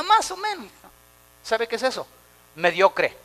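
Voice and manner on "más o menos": high, shrugging "más o menos" voice; mocking a lukewarm member who shrugs everything off; mock lazy answer. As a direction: play the half-hearted believer. Mocking a mediocre churchgoer.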